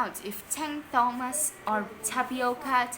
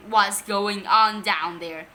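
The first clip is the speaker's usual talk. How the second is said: louder, slow call down the stairs; shouted; 8 dB louder, slower than usual. A narrator's reading. Dad woken at night calling downstairs. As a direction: call down sternly. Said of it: "shouted Dad".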